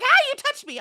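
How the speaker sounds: an indignant kitty complaining; offended cat. Retold or imitated. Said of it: "but he's like".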